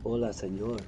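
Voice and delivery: soft Spanish accent imitation. Imitating a Spanish girl from Barcelona, Spanish accent. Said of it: "she was going".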